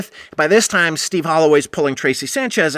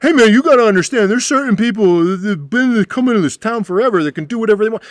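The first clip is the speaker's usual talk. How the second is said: placating bar owner; 8 semitones higher, 4 dB louder than usual. Retold or imitated. Friend defends the drunks.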